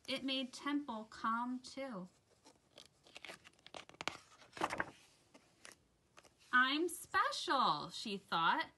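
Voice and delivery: put-on gentle rhyming read-aloud for children; soft, sing-song picture book reading; story narration. A narrator's reading. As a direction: read it like bedtime story time.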